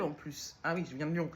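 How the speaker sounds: usual speaking voice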